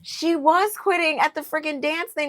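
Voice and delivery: high, exasperated disbelief; incredulous rant. Real, not acted. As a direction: call her bluff, fed up. Ranting about a contestant quitting.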